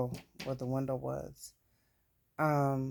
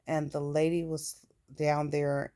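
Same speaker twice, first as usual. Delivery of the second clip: normal talking voice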